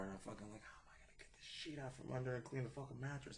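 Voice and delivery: soft unvoiced whisper through a laugh. Plotting the cleanup to himself, in a whisper. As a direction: scheme in a hush so no one wakes. Plotting quietly not to wake her.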